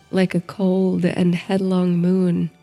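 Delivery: usual speaking voice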